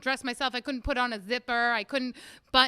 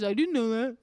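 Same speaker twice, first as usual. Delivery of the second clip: dopey husband's excuse, lower; clueless husband voice; 4 semitones lower than usual. Retold or imitated. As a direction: mumble it like the mocked husband. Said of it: "doesn't know anything".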